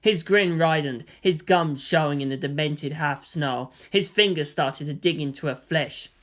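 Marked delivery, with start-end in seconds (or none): none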